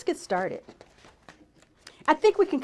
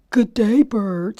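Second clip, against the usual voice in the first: voiced Fox; 8 dB louder, much slower than usual. A narrator's reading. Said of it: "said Fox".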